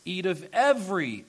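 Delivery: "every" pushed up and out; emphasis on every. Shown as a demonstration. Preacher stresses God's generous words, "every" emphasized. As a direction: hit "every" to show abundance.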